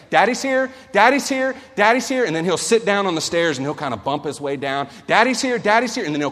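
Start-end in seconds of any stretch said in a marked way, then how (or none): 0.1-2.2 put-on child's call. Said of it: "It's like". 5.1-6.0 put-on child's call. Son calling from the stairs.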